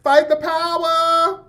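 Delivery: high, slow, drooping rally cry; limp power slogan. Acted. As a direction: stretch out a mock-militant chant way up high. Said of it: "the fist was a little limp".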